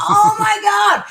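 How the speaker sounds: high, loud, slow crying exclamation; stretched-out tearful shout of wonder; overwhelmed cry. Retold or imitated. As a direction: let it burst out in tearful awe. Retelling overwhelming moment in car.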